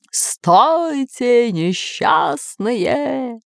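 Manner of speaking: eerie long-drawn call from the grave; sepulchral ghost voice. A narrator's reading. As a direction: draw each word out like a haunting spirit. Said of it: "раздался загробный голос откуда-то сверху".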